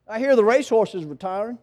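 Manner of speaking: usual speaking voice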